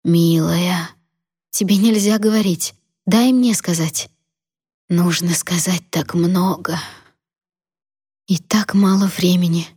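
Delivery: put-on weak old woman's whisper, slow; a dying grandmother whispering; frail shaky old whisper. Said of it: "прошептала".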